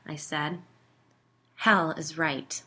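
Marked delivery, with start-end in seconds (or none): none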